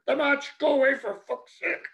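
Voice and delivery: silently screaming at him to leave; mock scream in the head; inner scream. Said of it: "My brain was screaming".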